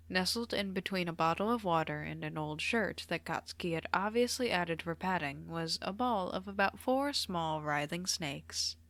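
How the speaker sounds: plain reading voice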